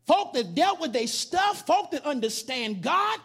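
loud, building preacher cadence; preaching. Acted. Preaching at full force.

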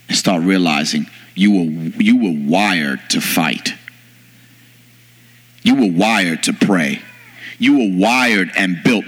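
low, slow, weighty exhortation; solemn preaching. Real, not acted. Preacher telling the church they were wired for the storm, solemn exhortation. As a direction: drop the pitch right down and space each phrase.

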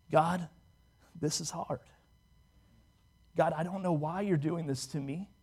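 soft, halting plea; quiet, troubled prayer. Acted. Believer praying to God in hardship, quiet troubled prayer. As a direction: confide softly, with pauses, in honest struggle.

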